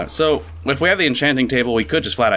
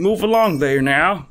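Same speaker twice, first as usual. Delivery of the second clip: slow, high mock prospector; 4 semitones higher, slower than usual. Gold rush prospector role.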